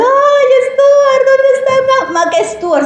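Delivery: put-on a child's dramatic fuss over her missing dog; childish wailing. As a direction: wail like a spoiled little girl. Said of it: "yo así armé mi escándalo".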